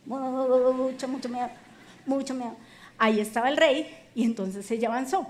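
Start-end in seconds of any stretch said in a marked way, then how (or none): 0.0-2.6 stammering, as if shivering with fright; mock trembling fear. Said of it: "Estaba temblando, tenía mucho miedo".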